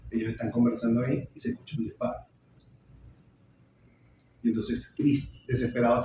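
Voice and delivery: plain reading voice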